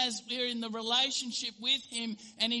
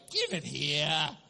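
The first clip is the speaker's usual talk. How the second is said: put-on lower, slow, grumpy old husband; gruff demand; 5 semitones lower, 5 dB louder, slower than usual. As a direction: grab the phone grudgingly. Grumpy old husband takes phone.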